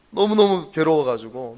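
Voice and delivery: 너무너무 hit hard and loud; emphatic anguish. Real, not acted. Stressing how painful it was.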